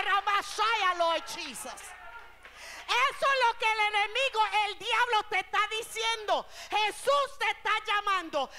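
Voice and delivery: loud, high, driving sermon voice; preaching at full force. Real, not acted. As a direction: hammer each phrase out to the congregation. (predicación encendida, fuerte y alta)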